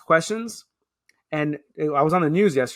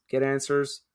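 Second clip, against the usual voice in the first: normal talking voice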